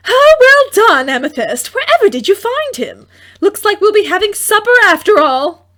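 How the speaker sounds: a mother thrilled at dinner; joyful mother. A narrator's reading. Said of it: "surprised joy".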